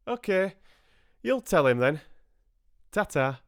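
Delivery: loud, high country voice; rustic farmer. A narrator's reading. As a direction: sign off heartily like a farmer. Farmer says goodbye.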